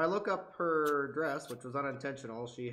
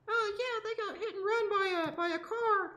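high squeaky bystander voice; high patient voice. Pretend. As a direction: answer in a high put-on voice. Voicing the patient's reply.

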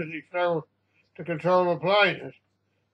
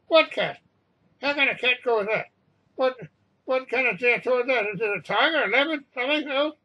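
high-pitched, agitated questioning voice; agitated intercom exchange; 6 semitones higher than usual. A narrator's reading. Captain and crew straining to hear over riot, agitated.

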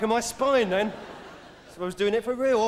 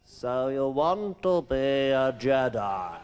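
put-on low, slow wise old Jedi; grave old mentor voice; Alec Guinness impression; 6 semitones lower, much slower than usual. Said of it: "Sir Alec Guinness".

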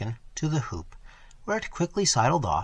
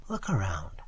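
soft, whispered and gentle; gently; 5 semitones lower, 5 dB quieter than usual. A narrator's reading. Girl to lost traveller on the moor, gentle whisper. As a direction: hush it kindly, close to a whisper. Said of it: "she said gently".

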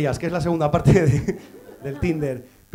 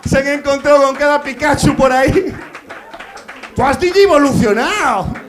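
mock taunt; 7 semitones higher, 9 dB louder than usual. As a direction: jeer it like a gleeful taunt. Mocking punchline.

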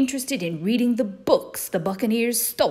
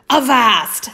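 loud, higher captain's shout; pirate cry; 5 semitones higher, 8 dB louder than usual. Acted. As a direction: call a halt like a pirate captain. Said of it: "cried Blackear".

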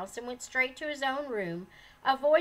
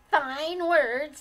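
higher, much louder scornful snap; snapped; 5 semitones higher, 10 dB louder than usual. A narrator's reading. Sullen old mother scorning her daughter-in-law, loud snap. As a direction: spit the words back at her. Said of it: "snapped the old lady".

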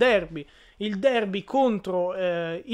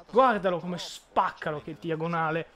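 usual speaking voice

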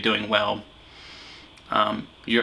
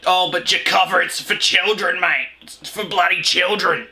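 raised pitch, put-on whiny complaint; mock objecting viewer; 6 semitones higher than usual. Reactor voicing viewers who excuse kids' shows, mock protest. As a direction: imitate an indignant commenter.

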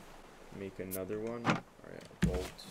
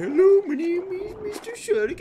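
much higher, louder cartoon greeting; silly shark voice; 14 dB louder than usual. Gamer imagining the shark greeting him, high loud cartoon voice. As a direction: play the shark as a goofy polite monster. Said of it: "he's gonna come around the corner and be like".

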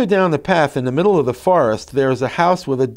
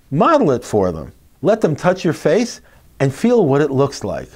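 usual speaking voice

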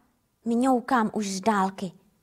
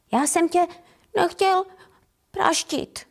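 higher, mildly snivelling little tomcat; whimpering; 4 semitones higher than usual. Acted. Little tomcat apologises to the kitten, mild whimper. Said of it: "fňukne".

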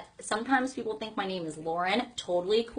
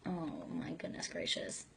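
whisper; 3 semitones lower, 14 dB quieter than usual. Real, not acted. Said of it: "whispered exclamation".

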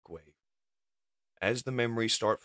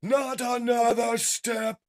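slow, loud high-pitched threat; shouted warning; 10 dB louder, slower than usual. Acted. Fighter halts others while grabbing Cliffjumper, shouting. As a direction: shout it, stretching each word.

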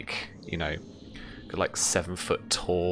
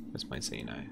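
soft, lower whisper to himself; whispered aside; 8 semitones lower, 12 dB quieter than usual. Real, not acted. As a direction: mutter while searching the table. GM muttering to himself.

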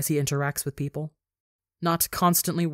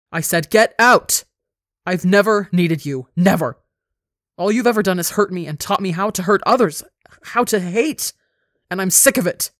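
loud, raised, enraged yelling; furious shriek; 3 semitones higher, 7 dB louder than usual. A narrator's reading.

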